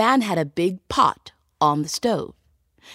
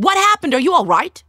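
high, louder alarmed call; 9 semitones higher, 4 dB louder, faster than usual. Acted. Tailor finds them in cellar.

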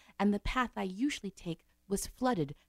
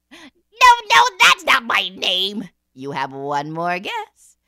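loud, slow gloating imp; little man voice; 14 dB louder, much slower than usual. Acted. The imp warns the queen of one more day, loud. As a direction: lord it over her like a cheeky imp.

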